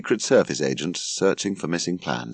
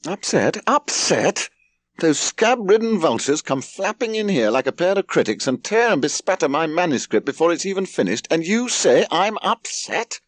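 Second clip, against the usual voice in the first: much higher, incredulous indignant tirade; furious brother scorning mother's excuse, much higher; outraged; 11 semitones higher than usual. Acted. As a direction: fling her word back at her, pitched high in disbelief.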